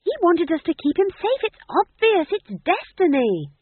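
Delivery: high, loud insistent shout. A narrator's reading. Magrat shouting at the other witches, high and loud.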